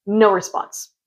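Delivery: usual speaking voice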